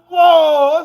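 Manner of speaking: high, loud shocked whoa; stunned loud whoa; startled whoa. Retold or imitated. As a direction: recoil in surprise. Shocked reaction to dog.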